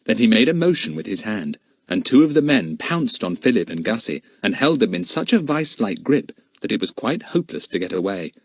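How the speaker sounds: plain reading voice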